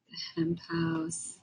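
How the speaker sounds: whispered room name. Real, not acted. Streamer whispering a chat room name to viewers. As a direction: breathe the name out, no voice.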